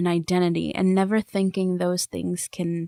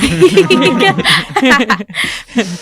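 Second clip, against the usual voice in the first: a real burst of laughter, louder; laughing; 5 semitones higher, 8 dB louder than usual. Real, not acted. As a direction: breaks into loud laughter mid-sentence. Laughing mid thought about America.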